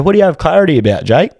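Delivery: lively imitation of a puzzled question; retold questioning. Mimics questioning the high uncle.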